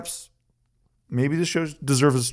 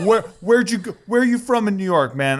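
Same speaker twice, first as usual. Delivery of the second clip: higher, laughing through an imitation of a pushy guy; mock aggressive stranger; 8 semitones higher than usual. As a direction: retell the stranger's grilling while cracking up. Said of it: "he is very aggressive".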